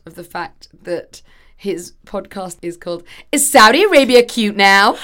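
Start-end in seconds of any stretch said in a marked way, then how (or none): 3.3-5.0 loud, dreadful put-on American accent; hammed-up American accent, much louder; [name]. Reading out his podcast title, put-on American accent. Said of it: "you saying it to me in this dreadful accent".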